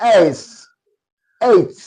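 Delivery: एच stressed, gliding 'ey' into the ending; corrected letter H. Shown as a demonstration. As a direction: lean on the vowel of एच, then release the consonant. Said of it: "नहीं, ऐसे नहीं".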